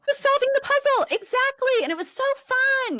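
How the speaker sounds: very high, gleeful squeal of agreement; excited agreement. Real, not acted. Agreeing with co-host about solving the puzzle, excited. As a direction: burst in high and loud with delight. Delighted agreement with co-host's point.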